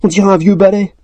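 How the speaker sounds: high, scandalised remark; dismayed teasing. A narrator's reading. Elf mocking the tangled hair, scandalised. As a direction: tease with theatrical horror.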